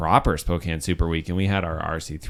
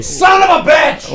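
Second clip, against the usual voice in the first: very high, slow, loud shout; mock outrage; 9 dB louder, slower than usual. Echoing a friend's curse, mock outrage. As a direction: holler it in playful indignation.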